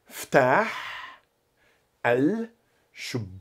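word by word, with long pauses; very slow, careful model for learners; slow repetition. Shown as a demonstration. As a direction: break it into slow separate pieces. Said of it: "Repete devagar".

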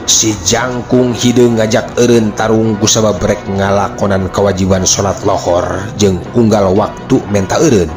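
ordinary delivery, nothing marked

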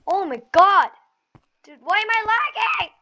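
high loud gamer outburst; hyped gamer bro. Real, not acted. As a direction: explode at the lag on stream. Gamer raging at lag.

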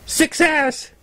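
high, bright cry of victory; triumphant whoop. Acted. Scooter whooping over the finished cake, high triumphant cry. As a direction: whoop it out like you just won. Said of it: "wild whoop".

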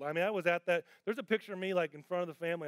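ordinary delivery

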